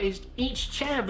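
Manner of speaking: mock your younger self insisting in a squeaky Southern voice; squeaky Southern accent on "chav". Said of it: "in my squeaky Southern voice".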